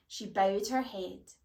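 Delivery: ordinary delivery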